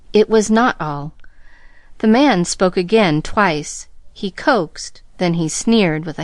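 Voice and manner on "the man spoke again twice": ordinary delivery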